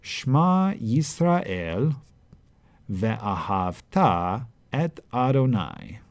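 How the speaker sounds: slow, lifted liturgical reading; Hebrew recitation. Shown as a demonstration. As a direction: intone it like reading from scripture.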